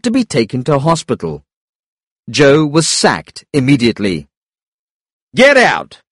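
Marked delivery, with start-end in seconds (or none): 5.4-6.0 contained narrated shout, pitched up; higher, moderately raised angry command. A narrator's reading. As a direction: order him out as a furious manager. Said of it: "shouted the kitchen manager".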